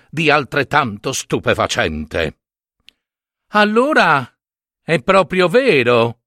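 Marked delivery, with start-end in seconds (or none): 3.5-6.2 high, excited cry of wonder; a farmer's wife gasps out her amazement; exclaiming. A narrator's reading. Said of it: "esclamò la moglie di un contadino".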